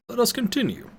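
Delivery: put-on high, thin voice of the old guide Pentagon; old wizard voice. Pentagon character voice, quoted.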